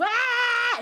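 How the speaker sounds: high, loud mock bawling; sudden drawn-out wail, imitated; imitated baby scream. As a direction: mimic a baby screaming itself red. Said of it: "quand il crie".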